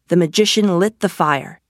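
ordinary delivery

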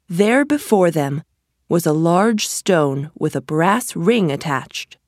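ordinary delivery, nothing marked

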